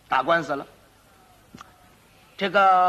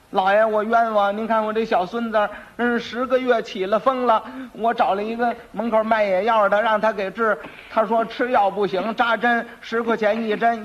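high, wailing plaintive testimony; aggrieved old woman; 4 semitones higher than usual. Acted. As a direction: plead injustice to the magistrate as a granny. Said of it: "老太太抱着这死孩子上来了".

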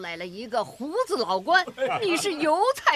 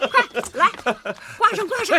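excited calling out; 7 semitones higher, 4 dB louder than usual. Acted. Wife excitedly hanging the certificate.

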